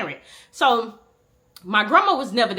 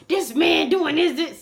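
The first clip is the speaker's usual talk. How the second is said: high, slow complaining voice; friend complaining; 7 semitones higher, slower than usual. Retold or imitated. Friend on the phone venting about his dad, high and slow. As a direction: drag it out like an annoyed friend. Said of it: "He'll call me".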